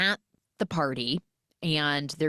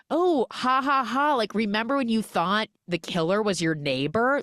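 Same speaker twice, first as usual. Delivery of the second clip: high, louder spoken ha-ha, then teasing; mock teasing laughter; 6 semitones higher, 4 dB louder than usual. Colleagues ribbing her about the killer, mock jolly teasing. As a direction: imitate a group having a laugh at someone.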